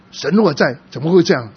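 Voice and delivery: a strong mock of his angry question to God; angry challenge. (复述他质问神，语调更高更响)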